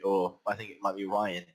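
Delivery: ordinary talk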